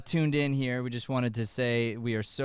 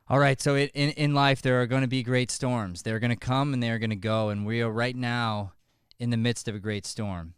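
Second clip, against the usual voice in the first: usual speaking voice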